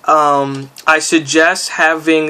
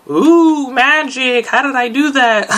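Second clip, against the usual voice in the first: mock amazement; 10 semitones higher, slower than usual. Pretend amazement at door opening.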